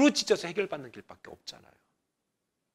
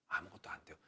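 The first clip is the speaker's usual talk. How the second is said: whisper; 17 dB quieter than usual. Real, not acted. Quiet aside in sermon.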